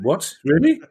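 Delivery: retelling his outraged shock at working Christmas Eve; shocked disbelief. Retold or imitated. Host recalls hearing of the Christmas Eve pod, high shocked disbelief. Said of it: "and I went".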